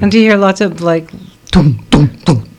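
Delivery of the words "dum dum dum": low booming dum dum; imitated bass thump.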